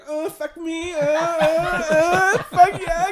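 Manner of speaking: high, far, whimpering laughing mock; mocking whine. Friend in back seat when his mom calls, mock crying whine. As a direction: ham up a teary mama's boy.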